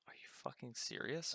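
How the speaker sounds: hushed, breathy aside of disbelief; muttered under the breath in shock; whispered disbelief. Retold or imitated. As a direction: whisper it so the cops can't hear. Retold disbelief at cops' reply.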